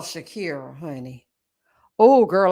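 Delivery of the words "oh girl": loud exclamation. Real, not acted. Reacting to hurricane story.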